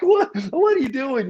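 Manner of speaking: high, disbelieving outburst; incredulous what. Retold or imitated. Retelling his shock at the boss's plan, voice jumping high. Disbelief at Vince's storyline idea.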